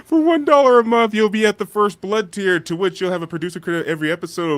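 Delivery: ad read. Acted. Ad read, pitch raised.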